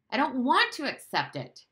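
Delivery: loud, high-pitched outburst; shouted protest. A narrator's reading. Boy defies his father before he knows it, shouting. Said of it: "shouted Bruno".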